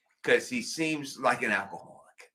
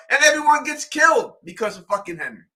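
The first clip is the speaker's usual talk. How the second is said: usual speaking voice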